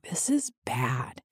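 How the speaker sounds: hushed, slow whisper; whispered dismay. Retold or imitated. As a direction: whisper it slowly, as a grim realisation. Voicing someone's grim realisation.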